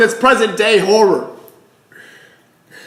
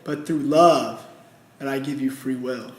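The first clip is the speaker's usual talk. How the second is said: low, soft and hushed, God answering gently; God's quiet answer; 8 semitones lower, 8 dB quieter than usual. Acted. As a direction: speak it low and quiet, a calm divine reply.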